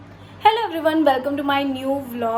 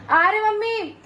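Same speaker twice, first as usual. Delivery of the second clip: raised high call to someone in the next room; 8 semitones higher than usual. Real, not acted. Asks mom if she's coming, calling out high.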